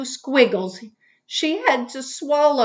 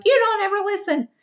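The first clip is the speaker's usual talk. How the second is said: higher, curt imitation of a snippy girl; imitating a curt, higher-pitched scold; sounding short; 10 semitones higher, faster than usual. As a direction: snap it like an annoyed kid. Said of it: "kind of sounded short".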